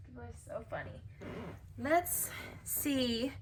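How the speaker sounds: ordinary delivery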